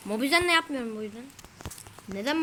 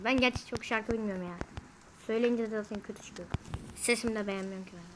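usual speaking voice

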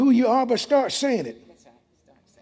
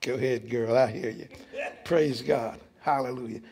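low aside; 10 semitones lower, 7 dB quieter than usual. Real, not acted.

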